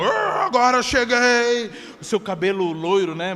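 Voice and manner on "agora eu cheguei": grand Thor. Pretend. Thor arriving with hammer.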